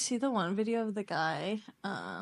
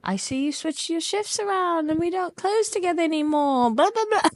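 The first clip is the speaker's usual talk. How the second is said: higher put-on British accent; British guy; 8 semitones higher, 4 dB louder than usual. Boss sulks that she switched shifts, mock British accent. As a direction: sulk in a hammed British voice. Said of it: "And he'd be like".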